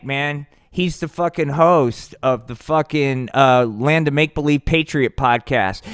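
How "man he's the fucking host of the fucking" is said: usual speaking voice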